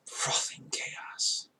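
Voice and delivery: slow hushed whisper; savoring whisper to himself; awed whisper. Real, not acted. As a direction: breathe it out in awe.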